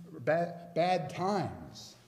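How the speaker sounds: ordinary talk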